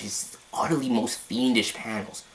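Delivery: ordinary delivery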